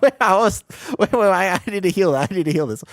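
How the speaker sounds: high, loud, words pushed through giggles; still laughing. Real, not acted. Streamer tries to heal while still laughing hard. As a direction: try to focus while still cracking up. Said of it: "I'm laughing too hard".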